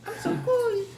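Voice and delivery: very high squealing fan voice; excited anime fan. Pretend. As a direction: gush like a giddy anime fan. Kids gushing in cute voices.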